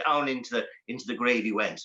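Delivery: ordinary delivery, nothing marked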